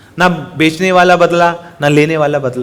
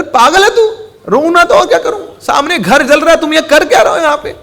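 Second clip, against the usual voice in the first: high-pitched, louder distressed outburst; distraught crying; 10 semitones higher, 3 dB louder than usual. Acted. Weeping father snaps at his son, distraught. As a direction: scold through mock tears. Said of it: "आप रो क्यों रहे हैं".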